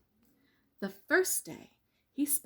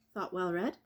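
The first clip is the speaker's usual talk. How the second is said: ordinary delivery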